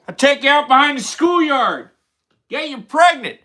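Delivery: put-on high, loud old man voice; boastful doddering old man; Joe Biden impression. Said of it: "Do the Sleepy Joe impression".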